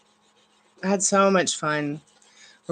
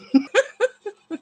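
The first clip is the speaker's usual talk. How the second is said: high laugh; 14 semitones higher, 4 dB louder than usual. Real, not acted. Laughing at own joke.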